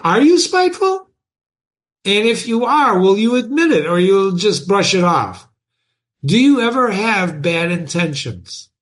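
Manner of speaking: teacher pressing students to admit it; animated challenge. Real, not acted. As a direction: needle the class with each question. Teacher challenging class directly.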